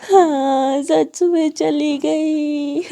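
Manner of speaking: very slow, drawn-out wail; loud crying. A narrator's reading. Girl left alone after the door slams, wailing. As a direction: bawl the words in disbelief. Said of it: "जोरों से रोती हुई कहती है".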